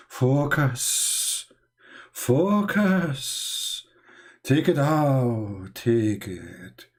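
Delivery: slow, drawn-out mock meditation; hushed, lingering yoga parody; mock yoga instructor. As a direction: stretch each word like a fake guru.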